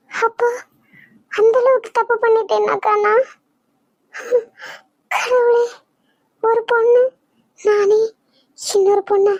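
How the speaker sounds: high, tearful sobbing plea; weeping, asking if it was so wrong; crying. Acted. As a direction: cry out the question through tears. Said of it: "எதுக்குடி இங்க நின்னு அழுதுட்டு நிக்கிற".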